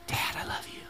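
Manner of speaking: whispered, soft and unvoiced; soft daughter's whisper. Daughter whispering love to her dad. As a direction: breathe it like a little girl.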